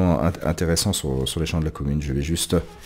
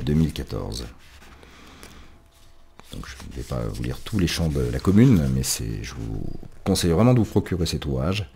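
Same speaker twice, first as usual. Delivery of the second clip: ordinary talk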